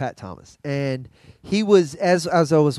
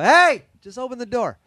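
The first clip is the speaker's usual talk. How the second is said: higher-pitched retold call; calling through door; 7 semitones higher, 3 dB louder than usual. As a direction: call to someone behind a closed door.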